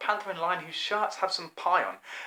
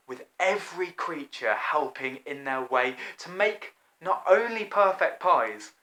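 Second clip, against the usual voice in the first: ordinary delivery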